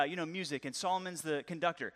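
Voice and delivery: ordinary talk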